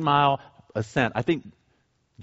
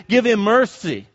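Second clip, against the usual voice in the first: normal talking voice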